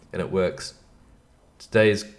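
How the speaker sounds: ordinary delivery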